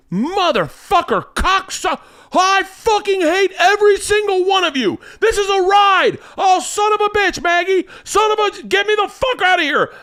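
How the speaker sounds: very high, frantic imitated tirade; dad cursing. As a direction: scream curses like a terrified dad on a ride. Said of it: "my dad cursed like that".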